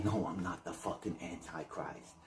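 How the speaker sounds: a schemer lying under his breath, fading to a whisper; sly villain voice. Retold or imitated. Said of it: "the Antichrist would say".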